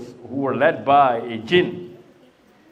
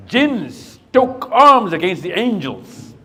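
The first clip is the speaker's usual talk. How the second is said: very slow, louder and higher, each word weighed; dramatic slow telling; 6 semitones higher, 7 dB louder, much slower than usual. Acted. Teacher telling the angels' war story, slow and loud. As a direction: tell it like the climax of an epic.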